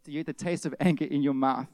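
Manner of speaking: ordinary delivery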